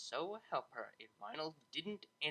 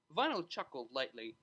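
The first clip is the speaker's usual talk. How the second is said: plain reading voice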